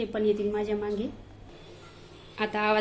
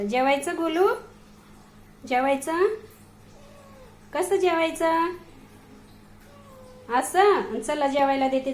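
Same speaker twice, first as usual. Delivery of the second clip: higher, louder coaxing pet talk; 5 semitones higher, 5 dB louder than usual. Real, not acted. Woman sweet-talks Golu at his dinner, higher and louder. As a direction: sweet-talk the cat to eat.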